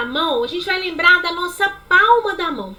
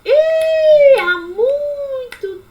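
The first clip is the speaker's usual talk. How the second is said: high "Ih", then "há muito tempo atrás" very slow; slow delivery; 4 semitones higher, much slower than usual. Shown as a demonstration. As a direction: drag the words out like a slow movement. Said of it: "exemplo de movimento lento". (fala lenta e arrastada)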